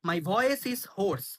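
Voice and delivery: very slow, clear teacher read; slow model phrase. Shown as a demonstration. Teacher models the English phrase, very slowly. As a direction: spell it out slowly for learners. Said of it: "আমার গলা বসে গেছে মানে".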